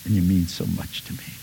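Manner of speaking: soft, tender offering, fading to a whisper; tender gift giver, soft to a hush; loving giver. Retold or imitated. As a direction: give it gently with love. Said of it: "an expression of love".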